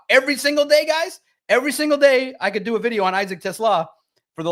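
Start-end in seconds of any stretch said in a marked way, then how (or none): 0.1-1.2 high, loud exasperated emphasis; really can't believe it. Real, not acted. 1.5-2.3 high, loud exasperated emphasis. Real, not acted.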